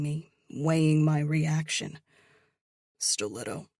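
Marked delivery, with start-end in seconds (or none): 3.0-3.7 low, soft and steady. A narrator's reading. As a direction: say it low and even. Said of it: "His tone is low, steady".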